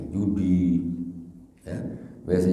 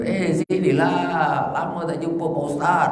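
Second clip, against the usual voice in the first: put-on old drinking buddy calling the new penitent over; old friend calling; 10 semitones higher, 4 dB louder than usual. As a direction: lure him back cheerfully. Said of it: "Dia kena".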